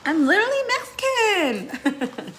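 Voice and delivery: high, slow laughing "literally Mexican"; a giggled, drawn-out protest. Real, not acted. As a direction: laugh it out, mock-offended. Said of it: "laughing self-mock".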